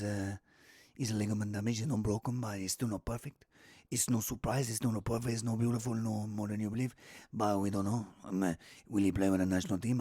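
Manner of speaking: very low, soft manager impression; Guardiola. Football manager on a player's injury, impersonated softly. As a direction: mumble low and quietly in an accented voice. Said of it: "Guardiola said today, he went".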